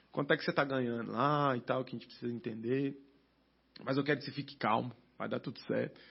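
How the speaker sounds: calm him down gently like the pastor; soft reassuring pastor. Retold or imitated. Pastor calming him, retold.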